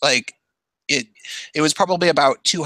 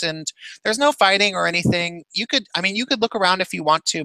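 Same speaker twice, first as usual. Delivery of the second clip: higher, sweet, over-polite voice; polite innocent kid; 5 semitones higher than usual. Retold or imitated. Sixteen-year-old on acid sweet-talking the cops, higher and over-polite. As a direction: play the good boy for the officer.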